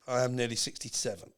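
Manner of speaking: ordinary talk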